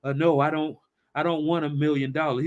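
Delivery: ordinary delivery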